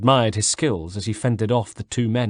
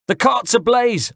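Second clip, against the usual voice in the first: put-on even higher, slow, shouted Corsican accent; Corsican rebel accent; 13 semitones higher, 4 dB louder than usual. A narrator's reading. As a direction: cry the alarm again as the rebel. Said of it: "I repeated".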